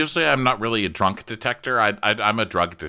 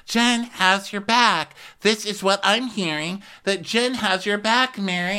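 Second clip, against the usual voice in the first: mocking Whitney; 9 semitones higher than usual.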